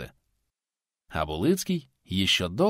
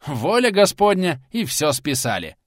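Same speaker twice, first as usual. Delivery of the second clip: angry retort; 6 semitones higher, 4 dB louder than usual. Acted. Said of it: "зло ответил пенсионер".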